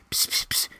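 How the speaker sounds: quick soft "psst" whisper noises; imitated whispering. Husband to wife during the movie, mimed whisper. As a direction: mimic a hushed word in someone's ear. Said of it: "whisper it quietly".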